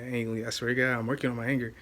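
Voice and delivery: ordinary talk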